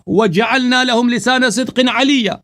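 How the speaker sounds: high, loud, forceful recital; loud recitation. Acted. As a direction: drown out the other man with the verse. Loud full verse recitation. (اونچی آواز میں زور دار تلاوت)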